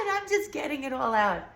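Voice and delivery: loud, high, coming down from a scream; shouted venting. Retold or imitated. Telling how she let it all out, loud high shout. Still acting out car scream.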